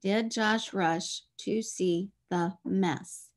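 the sentence read slowly, word by word; slow dictation. Shown as a demonstration. Teacher slowly repeating the sentence for counting words. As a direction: spell the sentence out at a slow pace. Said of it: "Seven words".

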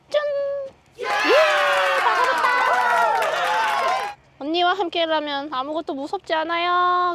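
high, loud triumphant shout; voicing the dog. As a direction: speak for the dog, cheering.

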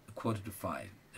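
a quarter to five, slow and soft. Shown as a demonstration. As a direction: repeat a quarter to five gently. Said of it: "repeated slowly, quieter".

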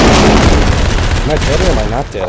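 loud, slow bewildered yell; loud confused shout. Real, not acted. Confused mid-firefight.

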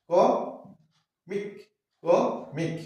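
"ক মিক" broken in two, a pause between ক and মিক; split into syllables. Shown as a demonstration. Teacher showing learners the two syllables, "ক মিক" split apart. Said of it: "দুবার".

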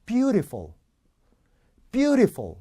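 Beautiful high, with clear "ee" in the middle; deliberately flawed pronunciation. Shown as a demonstration. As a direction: make every vowel of Beautiful distinct, "byu-ree-fol". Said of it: "뭘 틀리나 잡아보세요".

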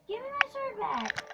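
high, indignant demand; yelling across the room. Real, not acted. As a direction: call out for it back. Demanding sword back.

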